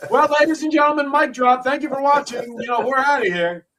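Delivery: high, quick mock host sign-off; deadpan sign-off. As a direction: wrap it up abruptly to camera. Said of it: "I looked deadpan in the camera".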